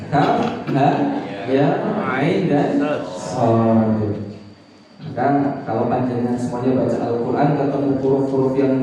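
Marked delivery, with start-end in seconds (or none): 0.1-4.0 slow, separate letter names with pauses. Shown as a demonstration. Preacher teaching the congregation the letters, slowly one by one. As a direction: pause after each letter and stretch the last.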